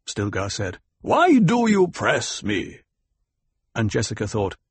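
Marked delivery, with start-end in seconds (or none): 1.0-2.8 high, slow, emphatic question; raised-pitch impatience; exasperated rebuke. A narrator's reading. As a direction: push back at a nagging challenger. Leader rebukes the dissenter.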